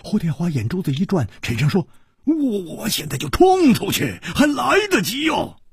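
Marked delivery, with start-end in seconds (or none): 2.3-5.6 much higher, near a shout; eager to charge out now; urgent. Acted. Hu Tiehua urging Chu Liuxiang to flee, high and urgent.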